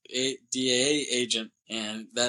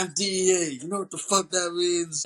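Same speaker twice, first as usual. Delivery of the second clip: mock yell; 7 semitones higher than usual. DEA agent shouting.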